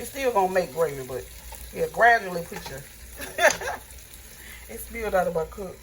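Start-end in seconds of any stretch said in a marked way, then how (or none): 2.9-4.6 airy laugh. Real, not acted. Laughs at spilled water.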